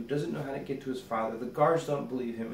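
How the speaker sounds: normal talking voice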